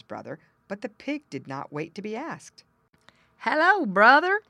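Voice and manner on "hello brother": high, loud grunting greeting; hearty pig grunt. Acted. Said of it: "he grunted".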